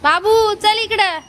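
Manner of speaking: loud call to the pet. Real, not acted. Owner calling dog to come, loud call. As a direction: call the dog over across the room.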